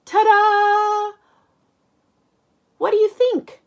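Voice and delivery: sung-out very high, loud ta-da; Max proud. Acted. Max shows off painting on Arthur, sung very high proud ta-da. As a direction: present it like a grand reveal. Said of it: "says Max".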